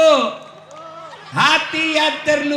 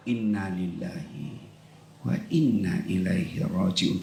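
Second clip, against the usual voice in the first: slow, soft and sincere, very low; slow heartfelt dhikr; 7 dB quieter than usual. Shown as a demonstration. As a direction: breathe it out gently from the heart. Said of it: "Ngucapnya sambil usap dada".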